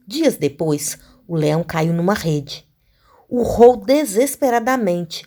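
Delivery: put-on slow, deliberate fable reading to children; story reading. A narrator's reading. Teacher reading the fable for pupils, slow storytelling. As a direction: read the fable slowly, phrase by phrase.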